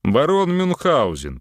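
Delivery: high, loud grand announcement; carried away. A narrator's reading. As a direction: announce yourself like a baron. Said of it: "понесло Антона".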